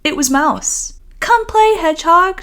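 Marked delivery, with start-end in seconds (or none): 1.2-2.4 high, loud call to come play; yelling. Acted. Mouse calling Hedgehog out to play through his door, high and loud. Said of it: "she yelled".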